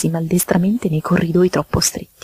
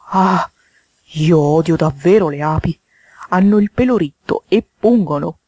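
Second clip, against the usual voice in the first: toad's disgusted outburst; mild cry of distaste; exclaimed loathing; 3 dB louder than usual. Acted. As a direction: exclaim your hatred of bees. Toad exclaims he hates bees.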